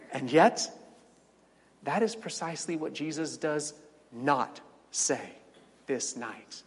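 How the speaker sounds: normal talking voice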